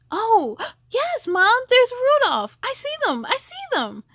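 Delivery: high gasping delight; a kid thrilled to spot Rudolph; boy gasping at the reindeer; excited child. A narrator's reading.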